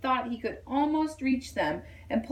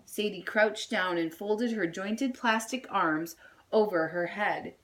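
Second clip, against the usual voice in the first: ordinary delivery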